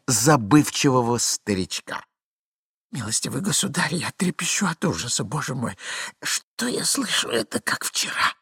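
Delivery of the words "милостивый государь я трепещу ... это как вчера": high, breathy trembling dread; hushed horror. Acted. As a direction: gasp it out in horror. Hidden man trembles with horror.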